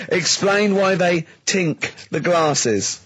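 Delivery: drawn-out mock demand; pressing a friend to explain, slow and teasing. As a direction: spell it out slowly to tease.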